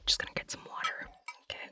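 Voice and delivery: whispered aside. Real, not acted. Aside while stepping away.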